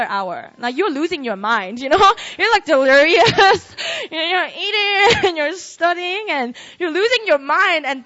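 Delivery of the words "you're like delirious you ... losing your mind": words through real laughter; laughing. Real, not acted. Preacher laughs describing a sleepless all-nighter, laughing through words. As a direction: laugh while describing exhaustion.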